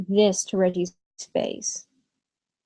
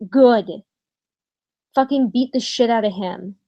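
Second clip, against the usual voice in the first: ordinary delivery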